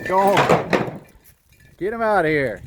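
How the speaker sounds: loud, high shout to drive them off; shouting at sheep. Real, not acted. Shooing the neighbor's sheep out of the yard, loud high shout.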